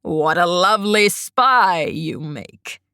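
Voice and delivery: put-on low, slow creepy old man voice; an old man sneering sweetly, low and slow; wheezy old man. A narrator's reading. Creepy old grandfather teases the hiding girl, low and slow.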